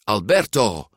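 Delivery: mildly surprised greeting; a touch of surprise on the name. A narrator's reading. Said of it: "zei Luca verbaasd". (licht verbaasd)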